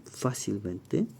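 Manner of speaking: "fácilmente" drawn out slowly; slow emphasis. Shown as a demonstration. Teacher pointing out the misspelled word, slow and deliberate. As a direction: lean on each syllable of "fácilmente", stressing the first A. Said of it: "aquí el error está aquí".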